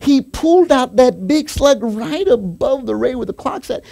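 high, impassioned testimony; preacher rising to a climax; fervent preaching. Real, not acted. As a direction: build the story with high, stretched key words. Preaches the story at full pitch.

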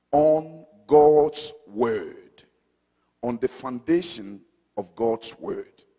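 low, measured preaching; calm sermon opening. Acted. Preacher introducing the sermon title to the congregation, measured. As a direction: lay out the sermon points slowly and steadily.

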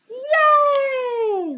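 high excited yay; cheer. Real, not acted. Cheer for friends.

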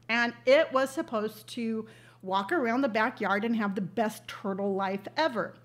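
ordinary delivery

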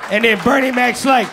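ordinary delivery

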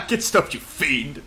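slow, hammed-up nineteen-fifties English accent; terrible fifties accent. Gamer telling off an imagined fiend, terrible fifties accent. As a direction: drag it out in a badly faked old-time accent. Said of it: "That was a terrible accent off me".

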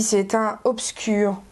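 ordinary talk

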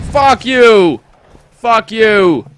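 angry yell. Real, not acted. Gamer cursing at an enemy on screen, furious shout. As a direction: bellow it at the screen.

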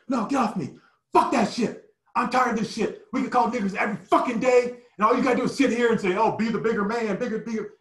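high, heated protest while held back; angry outburst. Retold or imitated. Teammates pulling him back, high heated angry protest. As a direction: shout it while being pulled away. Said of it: "I was like".

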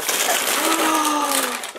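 long, high, softly drawn gasp; awed gasp. Real, not acted. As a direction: gasp slowly in real amazement. Sees the huge album.

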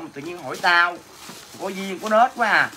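normal talking voice

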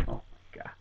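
breathless whispered disbelief; stunned whisper. Acted. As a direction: whisper it in shock. Manager stunned by the answer.

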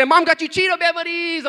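high, loud imitation of a child; blurted child's yell. Daughter revealing Mom's gift, imitated yell. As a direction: yell it out like an excited kid. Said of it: "she just went yell 'em out".

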